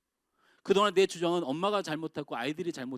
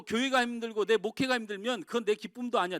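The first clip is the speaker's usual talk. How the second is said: normal talking voice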